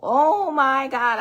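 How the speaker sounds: loud slow Oh my God in shock; retold shocked outburst; shocked exclamation. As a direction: blurt it out, stunned. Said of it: "I was like".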